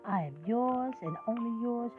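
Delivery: ordinary talk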